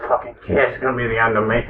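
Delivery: low, fast, grumbled under breath; grumbling aside. Acted. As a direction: mutter it fast to yourself. Older guard grumbles aside.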